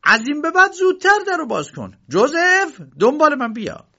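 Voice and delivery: high, forceful scolding orders; irate uncle storming in; angry. Acted. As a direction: order them about crossly at high pitch. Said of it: "با عصبانیت وارد شد".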